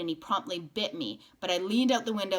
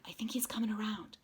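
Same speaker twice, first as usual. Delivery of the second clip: soft whisper over the patient; 4 semitones higher, 5 dB quieter than usual. Acted. Stranger whispering while sponging the injured boy's face. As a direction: whisper so you don't wake him. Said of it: "a voice said".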